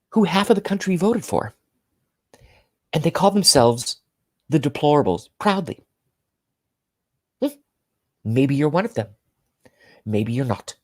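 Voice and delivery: high, sing-song mocking anchor voice; Rachel Maddow impression. Mocking a TV anchor: high, smug, sing-song. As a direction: play a smug newscaster quizzing the viewer. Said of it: "I'm gonna try to do Rachel Maddow".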